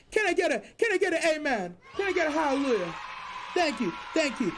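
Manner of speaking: laughing preacher call-out. Acted. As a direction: ask for an amen like church. Calls for amen like preacher.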